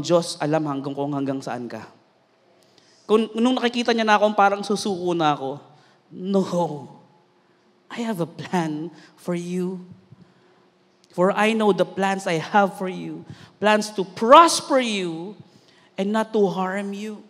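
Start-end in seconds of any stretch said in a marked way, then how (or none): 6.1-17.2 slow, measured divine promise; unhurried, solemn comforting voice; God's reassurance. Acted. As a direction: give the promise gently and deliberately. Voices God's reassuring answer to him.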